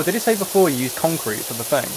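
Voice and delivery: ordinary delivery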